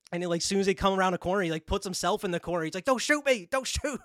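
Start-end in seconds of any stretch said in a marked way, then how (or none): 2.9-4.0 high mock begging; retold panic, pitched up; panicked plea. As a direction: act out a cornered man pleading. Cornered man begs police not to shoot.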